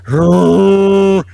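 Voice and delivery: laughs it out loud, drawn out; laughing shout. Real, not acted. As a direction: bursts out laughing on the word.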